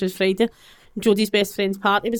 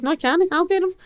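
retold defiance; 10 semitones higher than usual. Retelling her refusal to police.

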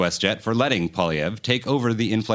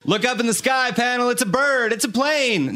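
superhero announcer; 11 semitones higher than usual. Pretend. Superman-style sky announcement parody.

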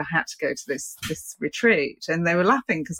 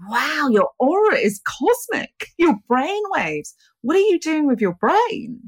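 amazed; 9 semitones higher, 4 dB louder, slower than usual. Retold or imitated. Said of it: "He went".

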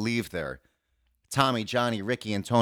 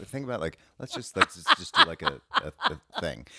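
ordinary talk

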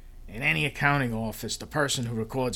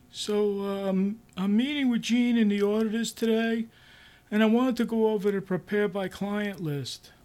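higher, hesitant boss voice; awkward boss; 10 semitones higher, 3 dB louder than usual. Acted. New boss going over the audit list, higher awkward voice. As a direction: fumble through it like an unsure manager. Bohr briefing Harold.

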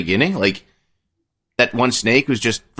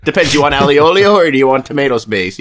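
put-on louder, higher, playful Italian accent; 4 semitones higher, 5 dB louder than usual. Co-host joking about pasta sauce, put-on Italian accent. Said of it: "putting on an Italian accent".

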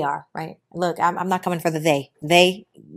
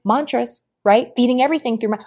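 normal talking voice

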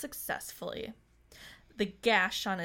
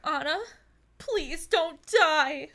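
slow, louder tearful plea; cracking tearful heartbreak; 6 semitones higher, 6 dB louder, slower than usual. Acted. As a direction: beg her not to die, voice cracking. Gerard pleads over dead Anna.

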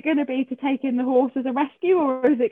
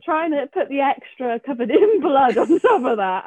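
ordinary delivery

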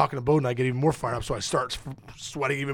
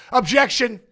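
mock lawyer objection; 7 semitones higher, 5 dB louder, slower than usual.

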